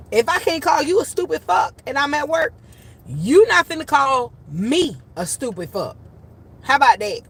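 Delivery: high, loud, emphatic telling-off; indignant scolding. Retold or imitated. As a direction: lay down the law to a cursing kid, punching the contrast. Retold scolding of cursing child.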